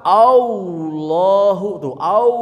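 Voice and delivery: "Allahu" as "Auuloh", high and loud; wau error. Shown as a demonstration. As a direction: slip a wau into "Allahu" with pouted lips. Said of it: "aya wawuan".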